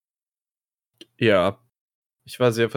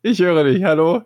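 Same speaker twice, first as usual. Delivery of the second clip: louder, raised to carry; calling out; 8 semitones higher, 5 dB louder than usual. Real, not acted. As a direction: call out to be heard. Calling over a broken connection.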